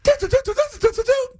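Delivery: high-pitched sung-out dial tones; quick "do" beeps, very high; phone dialing beeps. Acted. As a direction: imitate redialing with high beeps.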